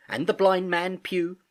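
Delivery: plain reading voice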